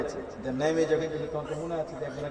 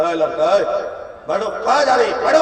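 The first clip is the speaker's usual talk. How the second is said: put-on a louder, scolding shout at the boy; mock angry preacher; 2 semitones higher, 8 dB louder than usual. Preacher scolds a boy leaving the stage, mock annoyed. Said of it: "বক্তার মিজাজ খুব খারাপ".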